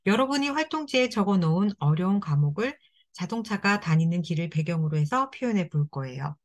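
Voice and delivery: usual speaking voice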